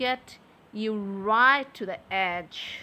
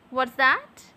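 ordinary delivery

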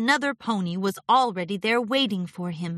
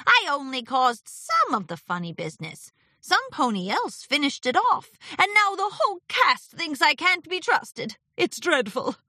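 higher, put-upon character voice; aggrieved Discord, protesting; 8 semitones higher than usual. Acted. Discord mistrusted by the cast, protesting. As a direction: protest the unfairness, pitched up.

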